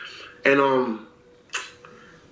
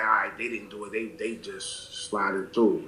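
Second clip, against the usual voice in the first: inner thought; 8 semitones lower, 8 dB quieter than usual. Retold or imitated. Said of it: "in his head, he's like".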